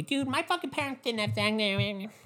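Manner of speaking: high, soft, slow mocking voice; Dana. Friend with an April birthday protests the theory, in a high soft mocking voice. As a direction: mimic an objection in a small high voice. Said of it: "like, Dana with an April birthday".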